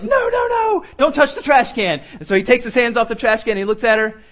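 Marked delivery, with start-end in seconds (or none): 0.0-2.0 sudden high panicked no, no, no; alarmed mom. Retold or imitated. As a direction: shriek at the toddler to let go of the trash can. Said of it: "she's like".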